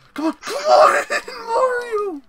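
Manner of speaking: very high, wailing plea; desperate shouting. Real, not acted. Player pleading with Mario, desperate shout. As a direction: cry out at the game in desperation.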